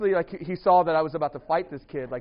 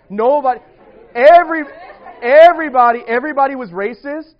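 much louder, higher, laughing it out; laughing exclamation; 8 semitones higher, 17 dB louder than usual. Real, not acted. Telling the audience about his old school, loud laughing blurt.

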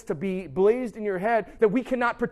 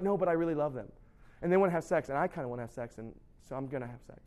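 low mock rationalizing, fading softer; sheepish self-justifying; 7 semitones lower, 8 dB quieter, faster than usual. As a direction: trail off as you justify yourself. Voices student justifying self, fading away.

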